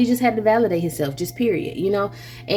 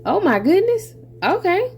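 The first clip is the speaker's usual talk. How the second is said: high, surprised what the heck; playful mock shock, pitched high; mock surprise; 9 semitones higher, slower than usual. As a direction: act startled and taken aback. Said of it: "You're gonna be like".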